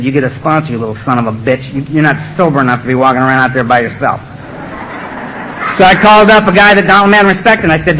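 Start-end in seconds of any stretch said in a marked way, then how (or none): 0.0-4.2 low, soft gruff scolding; lightly voiced stern old sponsor; Donald's voice. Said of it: "I heard a voice in my head".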